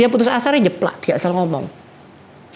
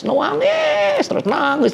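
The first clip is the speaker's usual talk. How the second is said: put-on much higher, a wailing child's cry; much slower than usual. Preacher imitating the little child's crying at the wife's house, mock high wail. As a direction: wail the word like a small child. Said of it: "dibuat nangis".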